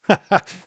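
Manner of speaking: high, loud real laugh; burst of laughter. Real, not acted. Laughing at kid photo.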